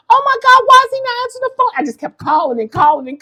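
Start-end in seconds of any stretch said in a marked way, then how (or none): 0.1-1.7 high, fast panicked worry; frantic. Retold or imitated. Mother frantic while her son won't answer.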